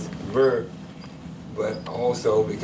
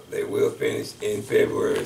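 normal talking voice

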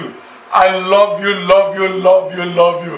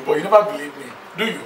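usual speaking voice

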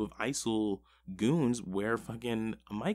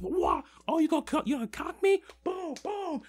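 very high, loud hammed-up yelling; mock fight yell; 3 dB louder than usual. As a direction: yell it like you're beating someone up. Acting out Whip's beatdown.

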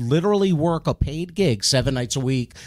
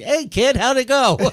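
put-on teasing old comic; 10 semitones higher than usual. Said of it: "they went".

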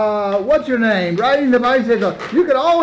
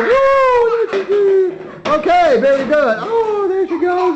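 excited; 8 semitones higher than usual. Real, not acted. Wolf doused with magic water.

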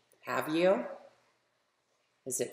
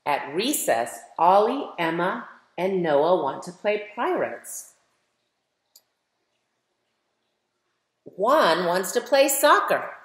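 put-on lively, louder storybook reading for kids; reading to children; 4 semitones higher, 7 dB louder than usual. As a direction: read it like a picture book for a class, brightly. Reads picture book aloud to children.

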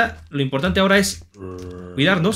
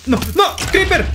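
sudden high yelp of alarm at the creeper; startled yell; 9 semitones higher than usual. Real, not acted. Gamer spotting a creeper cries out in alarm. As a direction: cry out as the creeper pops up.

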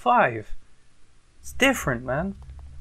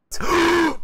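gasp; 10 semitones higher than usual. Real, not acted. Sudden surprise at cutscene.

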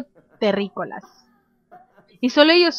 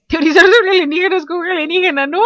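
higher, louder gleeful exclaiming; amused excitement; 5 semitones higher, 6 dB louder, faster than usual. Real, not acted. Gamer relishes theorizing about aliens with an alien, gleeful. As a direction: blurt it out, delighted by the absurdity. Then delights in the idea.

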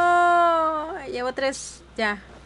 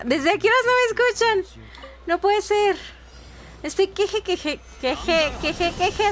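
exasperated call; 6 semitones higher than usual. Real, not acted. Mic not heard, frustrated.

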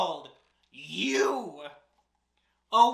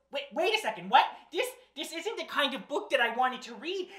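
plain reading voice

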